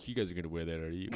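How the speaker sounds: casual put-on cool voice; mock cool teen; trying to sound cool. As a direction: act too cool. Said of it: "trying to sound really cool".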